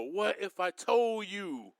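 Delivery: preacher pulls back to hush the crowd, softly and slowly. Shown as a demonstration. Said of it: "Let me calm down a little bit".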